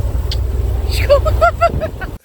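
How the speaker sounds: breathless squeaky laugh, no voice; airy high laugh. Real, not acted. After singing the chorus, a high breathy laugh.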